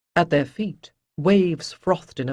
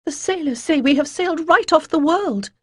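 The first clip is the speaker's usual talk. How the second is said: put-on high, nervous young voice, louder; young, exclaiming; 11 semitones higher, 5 dB louder than usual. A narrator's reading. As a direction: blurt it out with nervous energy. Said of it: "quivering with nervous energy".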